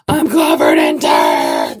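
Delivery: very low, loud, drawn-out bellow; mock anguished roar. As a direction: roar it slowly like a hammed-up monster. Comic outburst about the mess.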